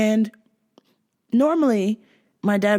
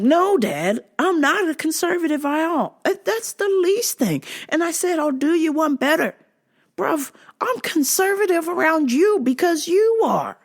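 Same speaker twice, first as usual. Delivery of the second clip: higher, insistent pushback; exasperated retort; 9 semitones higher than usual. Retold or imitated. Adult child rejecting dad's label, exasperated insistence. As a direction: argue back at dad in rising pitch. Acted argument with dad.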